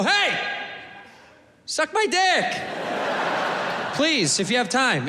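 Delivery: high, friendly and sweet; nice. Shown as a demonstration. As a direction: offer it kindly, way up high. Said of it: "We should say it nice".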